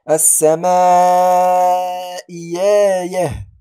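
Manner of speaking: "السماء يا يه" chanted, long vowel then a y glide; إبدال الهمزة ياء. Shown as a demonstration. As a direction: stretch "السماء" and slide into "يا يه" on y. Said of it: "نقول". (تلاوة "السماء يا يه" بمد ثم ياء)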